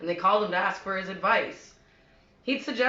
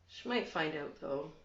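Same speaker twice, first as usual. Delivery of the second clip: much softer, with long pauses; hushed, halting menace; quiet veiled threat; 10 dB quieter than usual. Acted. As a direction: let it trail out softly, ominously. Veiled threat closing the monologue.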